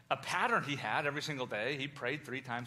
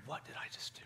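despairing whisper; 10 dB quieter, faster than usual. Retold or imitated. King wondering in despair.

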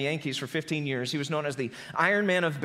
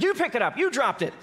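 higher, indignant retort with a laugh; yelled back; 6 semitones higher, 3 dB louder than usual. Retold or imitated. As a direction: throw it back, half laughing. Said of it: "the other player yelled back".

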